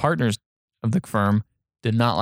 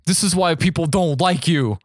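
higher, mock-indignant parting shot; retold angry jab; 5 semitones higher than usual. Student rebuking the man before leaving, mock anger. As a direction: throw it out as an acted rebuke.

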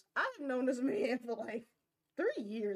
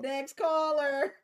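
slow, drawn-out, higher and louder host call; mock call-in host; 4 semitones higher, 5 dB louder, much slower than usual. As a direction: dismiss them like a radio host, stretching it out. Mock call-in host cutting her off.

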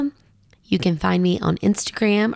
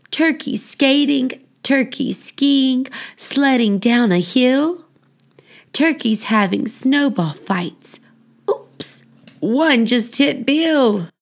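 lively, sing-song picture-book reading; reading to children; 5 semitones higher, slower than usual. Acted. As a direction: read it like a bedtime rhyme for little kids. Reads children's book aloud.

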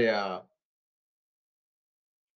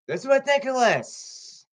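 indignant; 10 semitones higher, 4 dB louder, slower than usual. Real, not acted. Indignant high outburst.

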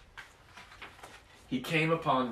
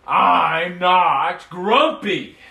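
loud, drawn-out, slow furious yell; stretched-out shouted denial; angry shout; 5 semitones higher, 13 dB louder, much slower than usual. Acted. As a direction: bellow it, beating your chest. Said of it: "And he shouted as he beat his chest".

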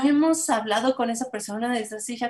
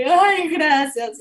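tearful thanks; 5 semitones higher, 6 dB louder, much slower than usual. Retold or imitated. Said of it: "mamá ya llorando".

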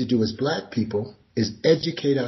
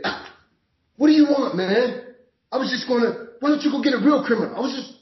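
mock belligerent driver; 12 semitones higher, faster than usual. Driver mouthing off to the officer, high and indignant. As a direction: sneer at the cop like he's wasting your time. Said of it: "But if I say".

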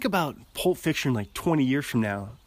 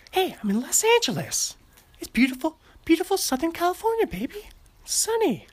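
much higher, put-on voice of a beaming movie star; Mark Wahlberg impression. Imitating an actor for podcast listeners, hammed-up and high. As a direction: gush about sunny California in a borrowed star voice. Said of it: "That's Mark Wahlberg in Los Angeles".

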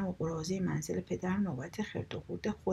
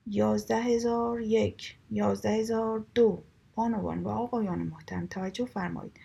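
auctioneer call; 2 semitones higher, 4 dB louder than usual. Acted. Kamal the auctioneer calling bids to the crowd, loud sing-song call. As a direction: call out the bids to the whole room.

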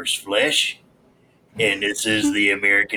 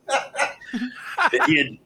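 laughing; much slower than usual. Real, not acted. Cracking up mid-reading.

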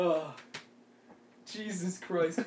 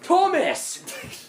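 calling out; 10 semitones higher than usual. Real, not acted. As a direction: shout for Thomas down the hall. Calling out to brother.

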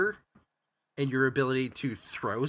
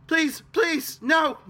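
high, loud frightened begging; desperate plea; 6 dB louder, slower than usual. Acted. Kenku mimics a terrified victim, desperate shout. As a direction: beg for your life, shouting. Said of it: "the voice just says".

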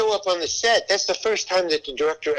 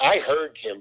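ordinary talk

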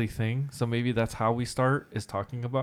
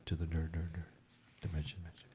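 low, soft announcer with fading echoes; mouth-made reverb, dying away; imitated reverb; 5 semitones lower, 14 dB quieter, faster than usual. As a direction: echo each key word, getting quieter. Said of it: "that nice little reverb going on".

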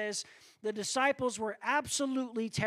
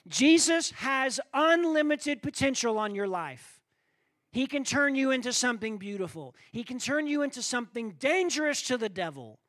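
louder, higher preacher's push; full-force preaching; 5 semitones higher, 5 dB louder than usual. Acted. Preacher building up to the congregation, full-force preaching. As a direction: drive the point home to the congregation.

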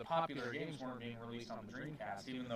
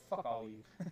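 ordinary talk, nothing marked

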